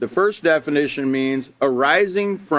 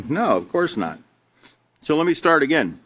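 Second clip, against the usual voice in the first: ordinary delivery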